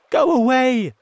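loud, high-pitched yell; shouting. A narrator's reading. Frightened friends to a shark, shouting. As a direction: shout to drive it off. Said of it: "they shouted".

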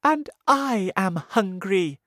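high loud ladybird cry. Acted. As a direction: call out it, high and eager. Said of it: "the ladybird cried".

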